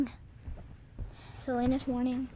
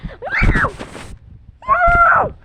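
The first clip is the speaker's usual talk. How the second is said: excited shout; 14 semitones higher, 13 dB louder than usual. Real, not acted. Excited during pillow trick.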